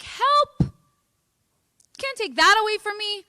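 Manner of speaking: high-pitched pleading cry for help; hammed-up alarm, pitched well up; mock panic. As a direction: plead as if your crutch is being taken. Inner self panics at losing habits.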